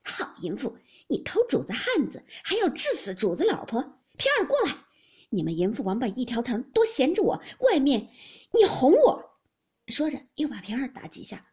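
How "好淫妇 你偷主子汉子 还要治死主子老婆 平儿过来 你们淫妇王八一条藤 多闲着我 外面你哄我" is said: loud scolding that climbs into high shouting; furious cursing. A narrator's reading. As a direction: curse them out, building to a high yell. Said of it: "堵着门站着骂道". (高声怒骂)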